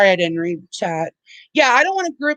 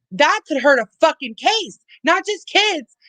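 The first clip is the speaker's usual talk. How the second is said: angry rant; 8 semitones higher than usual. Real, not acted. Streamer venting fury at critics in chat, high heated rant. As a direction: voice climbing much higher in heated outrage.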